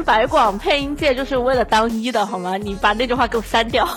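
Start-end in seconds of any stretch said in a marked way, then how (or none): none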